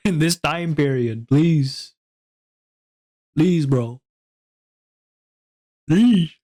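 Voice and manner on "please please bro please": pleading, rising to a louder please; a half-joking desperate plea; begging. Real, not acted. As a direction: beg the viewers for help. Begging chat for advice.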